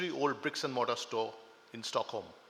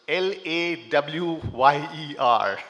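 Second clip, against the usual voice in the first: slow deliberate spelling; 9 dB louder, much slower than usual. Shown as a demonstration. Host clarifying the speaker's title to the audience, slow and deliberate. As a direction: spell out each letter slowly and clearly. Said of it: "to avoid any confusion".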